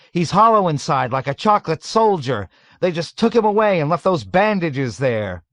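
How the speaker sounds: high, loud frantic insistence; shouting back. A narrator's reading. Dunbar explaining the empty bandages, shouting. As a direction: argue it at a shout. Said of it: "Dunbar shouted back".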